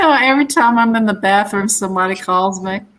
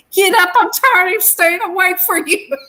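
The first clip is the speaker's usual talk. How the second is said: angry yell; 8 semitones higher than usual. Retold or imitated. Retelling yelling at lazy brother.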